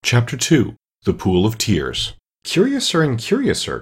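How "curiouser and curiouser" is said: higher, amazed exclamation; cried out in wonder. A narrator's reading. Alice crying out in wonder as she grows. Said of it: "cried Alice".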